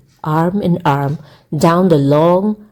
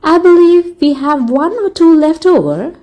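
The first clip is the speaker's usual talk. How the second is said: put-on high, bright young lady clerk, somewhat louder; shop clerk voice; 11 semitones higher, 4 dB louder than usual. A narrator's reading. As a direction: voice the dashing young clerk lightly. Shop clerk answers Matthew.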